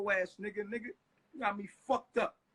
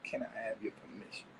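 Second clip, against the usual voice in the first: mocking his request; 13 dB quieter than usual. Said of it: "Trying to make it seem like".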